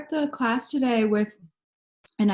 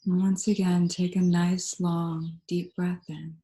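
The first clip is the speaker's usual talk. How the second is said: calm, unhurried meditation voice with long pauses; guided meditation. Real, not acted. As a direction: guide them gently into stillness, leaving space between phrases.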